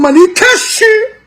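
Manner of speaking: high, mock crying whine; hammed-up crying; mock sob. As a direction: fake sobbing to ridicule. Mocking crying voice.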